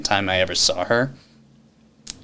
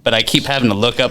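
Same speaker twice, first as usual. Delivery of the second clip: normal talking voice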